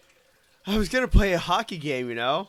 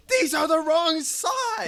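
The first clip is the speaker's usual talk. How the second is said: exasperated; 10 semitones higher, slower than usual. Retold or imitated. Rented skates wrong size.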